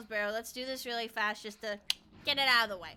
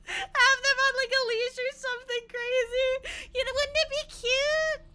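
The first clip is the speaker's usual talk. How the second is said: gushing; 10 semitones higher, 5 dB louder than usual. Real, not acted. Gushing about skeletons in backpacks.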